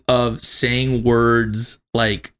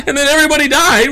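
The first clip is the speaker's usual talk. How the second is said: incredulous repeat; 6 dB louder, faster than usual. Real, not acted. Incredulous repeat of mom's line.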